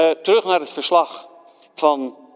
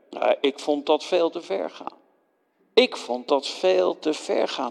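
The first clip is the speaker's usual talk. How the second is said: normal talking voice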